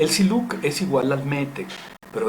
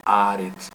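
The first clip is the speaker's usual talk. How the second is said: "haaretz" with a long stressed "a" after "ha"; stress on second A; 3 semitones lower, 5 dB louder than usual. Shown as a demonstration. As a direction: lean on the middle "a" of "haaretz". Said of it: "me está acentuando la palabra haaretz".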